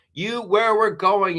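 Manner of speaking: quoting a movie line to a friend, building up; movie quote. Acted. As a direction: drawl it like a dramatic film line. Mock movie line about pants.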